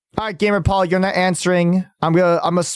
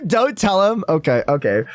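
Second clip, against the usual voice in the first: usual speaking voice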